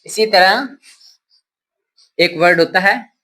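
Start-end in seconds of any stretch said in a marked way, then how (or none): none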